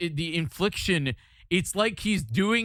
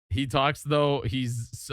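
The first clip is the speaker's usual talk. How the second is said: ordinary talk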